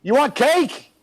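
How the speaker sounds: calling out to neighbor Troy. Retold or imitated. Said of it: "I'm like".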